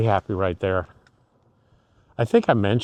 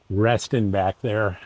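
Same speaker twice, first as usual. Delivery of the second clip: ordinary talk, nothing marked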